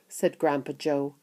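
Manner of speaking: ordinary delivery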